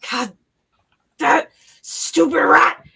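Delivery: a creature hissing in fury; growled insult; angry snarl. Acted. Character growls at the rat.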